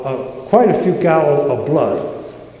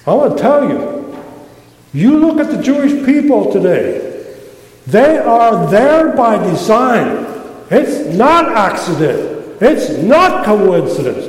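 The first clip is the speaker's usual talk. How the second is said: fired up preaching; 5 semitones higher, 3 dB louder than usual. Real, not acted. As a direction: preach it fired up, stressing not. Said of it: "I'm fired up right now".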